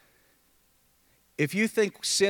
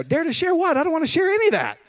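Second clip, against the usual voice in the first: put-on high, fast, complaining teen voice; mock whiny kid; 10 semitones higher, 3 dB louder than usual. Pastor imitating a teen who refuses to share faith, whiny. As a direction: whine it like a reluctant kid. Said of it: "I go".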